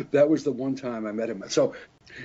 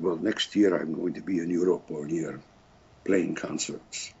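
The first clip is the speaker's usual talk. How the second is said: mock Polish accent; 6 semitones lower than usual. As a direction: stall her in a heavy Polish accent. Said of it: "[name]'s Polish accent".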